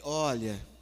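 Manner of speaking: slow, drawn-out soothing reply; unhurried, reassuring scripture quote; calm understanding counsel. Shown as a demonstration. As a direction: answer the rant gently, with long pauses. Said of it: "faz assim e fala ali pra ele".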